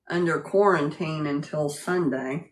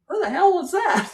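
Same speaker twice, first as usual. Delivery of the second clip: mock startled yell; 12 semitones higher, 4 dB louder than usual. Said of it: "and he's like".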